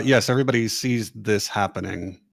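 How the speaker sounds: ordinary talk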